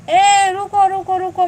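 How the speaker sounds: loud urgent call, ruko ruko; loud, pleading call to a departing viewer. Real, not acted. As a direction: shout रुको to stop someone leaving. Calling after viewer leaving live.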